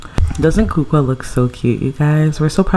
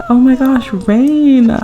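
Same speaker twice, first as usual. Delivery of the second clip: high, louder gushing fan squeal; starstruck fan; 10 semitones higher, 5 dB louder, much slower than usual. Retold or imitated. Fan meeting a famous singer, high excited gush. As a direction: gush like meeting your idol. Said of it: "So we're like".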